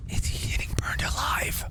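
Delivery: fearful whisper. Real, not acted.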